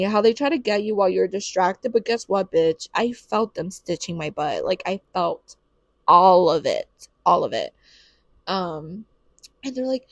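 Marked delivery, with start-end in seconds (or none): 6.1-7.7 loud, low, heavy stress on "all of it"; emphatic insistence. Real, not acted. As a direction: drag out "all of it", dropping low. Stresses feeling every stitch.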